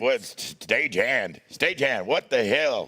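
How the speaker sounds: hint of a booming roadie boss; lightly voiced big gruff boss. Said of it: "that deep, that big, deep voice".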